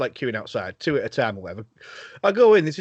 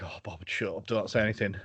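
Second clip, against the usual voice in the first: muttered aside; 7 semitones lower, 8 dB quieter than usual. Retold or imitated. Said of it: "And I was like".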